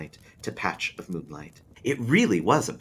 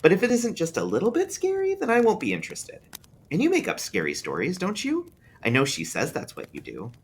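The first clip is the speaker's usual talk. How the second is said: put-on higher boy voice, weighing his words; a child reasoning it out carefully; young boy bargaining over a story; thoughtful small boy; 10 semitones higher than usual. A narrator's reading. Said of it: "he said thoughtfully".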